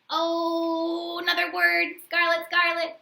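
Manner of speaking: high, bright thrill over a new word; delighted. Acted. Teacher reading to kids, excited by a word. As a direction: savour the word with glee.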